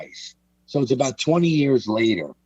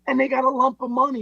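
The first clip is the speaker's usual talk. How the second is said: much higher, put-on voice of an indignant old gangster; old Pete's voice; 14 semitones higher than usual. Telling Mike about his father, indignant high old-man voice. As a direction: play Pete, outraged he laughs. Voicing old Pete, retold.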